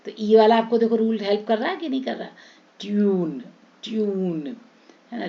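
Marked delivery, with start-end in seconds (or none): 2.8-4.6 ट्यून with a long "tyooon"; stretched yoo vowel. Shown as a demonstration. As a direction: draw out the "yoo" in each ट्यून. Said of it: "यू को हम लंबा खींचेंगे".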